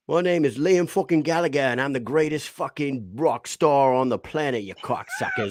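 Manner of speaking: boastful rock-star impression in a put-on English accent. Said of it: "our best English accent".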